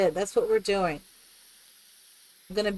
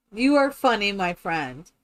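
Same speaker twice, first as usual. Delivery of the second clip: usual speaking voice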